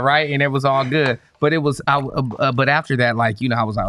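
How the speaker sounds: ordinary talk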